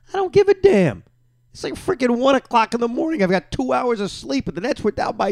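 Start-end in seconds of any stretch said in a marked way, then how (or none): 0.1-3.2 defiant outburst. Real, not acted. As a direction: fire it back, daring anyone to object. Defiant after criticism.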